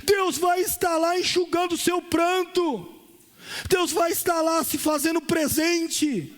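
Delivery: high, slow, swelling preacher's cry; preaching. Acted. Pastor preaching to the church with rising fervor. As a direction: pour it out over the worship music. Preacher in full force over music.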